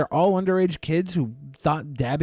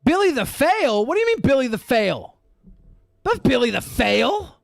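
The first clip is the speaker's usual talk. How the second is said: indignant; 8 semitones higher than usual. Real, not acted. Indignant protest over misheard joke.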